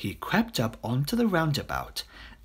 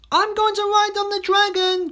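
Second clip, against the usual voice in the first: put-on very high, loud child voice; excited child's cry; 6 dB louder than usual. A narrator's reading. Child running to the roundabout, excited high-pitched cry. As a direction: call it out with glee. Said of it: "cried one".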